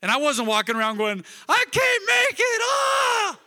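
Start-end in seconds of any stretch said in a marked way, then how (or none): 1.5-3.4 high, loud mock wail of despair. Pastor mocking an overdramatic mourner with a loud wail. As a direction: ham up a melodramatic cry of anguish.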